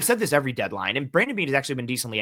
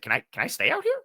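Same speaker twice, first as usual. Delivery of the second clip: higher, earnest hopeful asking; hopeful request to the doctors, mildly imitated; genuine question; 12 semitones higher, faster than usual. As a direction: ask it like you truly want permission. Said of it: "poses it like a genuine question".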